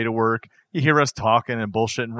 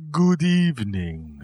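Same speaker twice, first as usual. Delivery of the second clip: slow, formal good evening; like a gentleman by the fire; stately host voice; much slower than usual. Acted. Posh fireside host voice.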